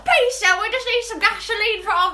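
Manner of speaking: put-on high, loud pleading teen voice; frightened victim voice. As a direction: beg like a scared horror movie victim. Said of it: "She's done five voices in one minute".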